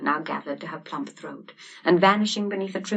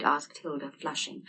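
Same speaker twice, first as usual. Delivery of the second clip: ordinary delivery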